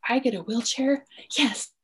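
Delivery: put-on child's delighted high-pitched reaction; excited child. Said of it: "he paused for a minute and said".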